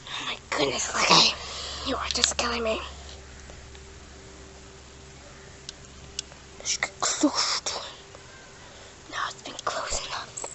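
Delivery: soft whisper near a pet. Real, not acted. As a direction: hush so the bird stays calm.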